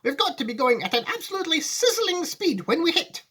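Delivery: high-pitched, zestful insistence; Mister Wonka. Acted. Wonka explaining the sizzling speed, high and excited. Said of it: "said Mister Wonka".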